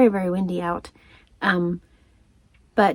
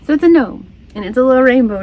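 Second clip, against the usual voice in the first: higher and louder with delight; delighted gnome reveal; excited reveal; 4 semitones higher, 9 dB louder, faster than usual. Real, not acted. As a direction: show off the gift, thrilled.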